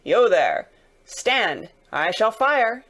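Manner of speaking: guard's loud warning shout; shouted challenge. Acted. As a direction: call a halt to an approaching rider. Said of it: "the guard sang out, as loud as he could roar".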